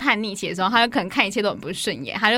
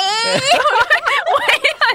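burst of laughter; 7 dB louder than usual. Real, not acted. Laughing at her own drawn hello.

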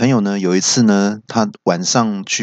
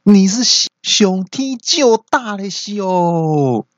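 high, drawn-out nagging tirade; hammed-up telling-off, stretching each phrase ending; scolding wife; 10 semitones higher, slower than usual. Wife slapping her husband's head, scolding. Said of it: "一边骂说".

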